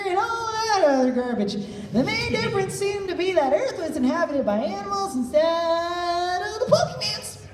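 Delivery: high, sing-song Mew voice. Acted. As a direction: let Mew's speech lilt high like a tune. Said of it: "Still you".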